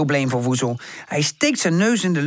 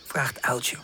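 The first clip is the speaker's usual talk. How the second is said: usual speaking voice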